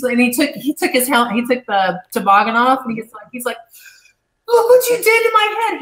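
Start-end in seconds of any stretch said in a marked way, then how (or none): 4.5-5.8 high, whiny mock sob; imitating crying man. Retelling how her attacker whined at her, high mock crying. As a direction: mimic the weeping attacker, pitched way up. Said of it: "He started crying".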